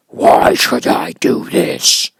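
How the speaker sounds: slow, high, loud monster demand; demanding beast. Acted. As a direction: challenge him like a towering beast. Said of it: "demanded the beast".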